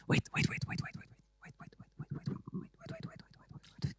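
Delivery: rapid hushed whispered waits; frantic whisper. Real, not acted. Host stalls the show offstage, whispering urgently. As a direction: hiss the waits under your breath to stall.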